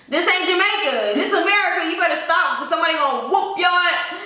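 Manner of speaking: high, loud and fed up, scolding Mom; loud retort. Retold or imitated. Daughter scolding her mom about Jamaica, loud and high. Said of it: "And I'm like".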